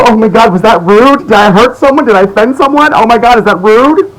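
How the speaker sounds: high, fussy imitation of an anxious worrier; mock fretful people-pleaser. Vlogger mocking mask-wearers who fear offending, high fussy mimicry. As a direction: mimic someone panicking about being rude. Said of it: "'Cause you're so".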